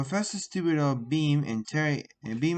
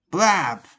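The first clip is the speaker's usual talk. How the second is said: sound effect; 4 semitones higher, 9 dB louder than usual. A narrator's reading.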